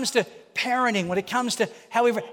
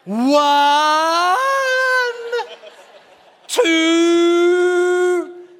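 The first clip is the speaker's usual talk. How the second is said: shouted countdown, higher and louder, the last count drawn out slow; mock shouting parent; 7 semitones higher, 6 dB louder, much slower than usual. Preacher mocking a parent who never follows through, hammed-up shouting. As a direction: yell the count, then stall on two and a half.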